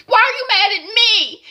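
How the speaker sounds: high tearful whine; mock crying. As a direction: whimper it on the edge of tears. Zora upset, crying.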